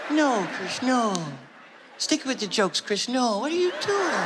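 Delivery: high, gentle admonishing voice; drawn-out high reproach on the last question. Retold or imitated. [name] telling [name] to stick with jokes, dismayed. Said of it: "And [name] was like".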